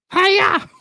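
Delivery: very high-pitched puppet yelp; sudden falsetto "hi-yah"; Piggy. Acted. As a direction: squeal it like a karate-chop cry. Acts Piggy answering Kermit.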